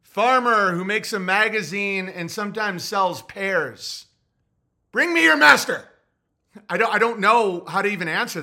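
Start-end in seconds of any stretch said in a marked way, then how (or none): 0.0-4.1 mock earnest listing. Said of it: "What do you do for a living". 4.9-5.9 high, loud imperious command; mock medieval lord. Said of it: "the post office skit".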